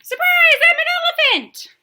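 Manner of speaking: very high, loud squeal of glee; triumphant chicken shriek. Acted. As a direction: shriek the surprise like a giddy little chicken. Chicken's surprise twist.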